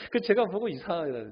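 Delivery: ordinary delivery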